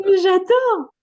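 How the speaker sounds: high, loud delighted exclamation. Real, not acted. Host reacting to guest dropping Chinese studies for dance, delighted. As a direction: burst out with real delight.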